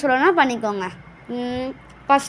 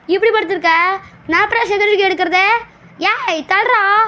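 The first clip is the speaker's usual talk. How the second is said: higher-pitched, exasperated demand; irritated scolding; 6 semitones higher than usual. Real, not acted. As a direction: scold someone blocking the way. Scolding a game character to move. (எரிச்சலா அதட்டல்)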